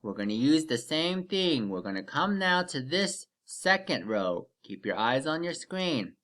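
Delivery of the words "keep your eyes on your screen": ordinary delivery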